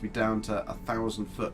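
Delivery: ordinary talk, nothing marked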